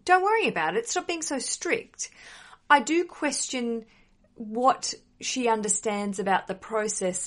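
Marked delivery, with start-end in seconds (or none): 0.0-2.1 mock mum brushing it off, high-pitched; high, dismissive impression of a mother; imitated mum. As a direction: put on a breezy mum voice, pitched way up. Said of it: "when your mum says".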